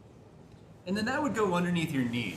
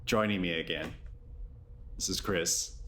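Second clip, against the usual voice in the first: low, measured yoga nidra voice with long pauses; guided meditation; 8 semitones lower, 7 dB quieter than usual. Acted. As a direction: guide them gently into rest, low-pitched and unhurried.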